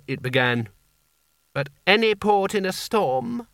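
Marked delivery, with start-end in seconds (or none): 1.5-3.5 slow, high potentate voice; a hint of a hurried sultan; old sultan voice. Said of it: "said Creosote hurriedly".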